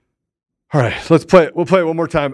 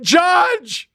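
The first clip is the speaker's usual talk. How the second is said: mock shouted greeting; 12 semitones higher, much slower than usual. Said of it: "came flying around and said".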